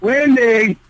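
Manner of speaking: high, drawn-out name call; calling to attention. Acted. Interrupting a quarrel by name, high call.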